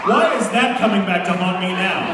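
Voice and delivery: loud, high comic dismay; exasperated outcry. Real, not acted. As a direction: wail it as an embarrassed protest. Embarrassing old joke resurfaces.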